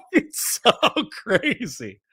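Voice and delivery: high laugh breaking through the words. Real, not acted. Streamer laughing at his own boot camp story.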